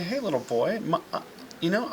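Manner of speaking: a mother pretending not to know her son; playful stranger. Mom playfully greets her boy like a stranger, sing-song.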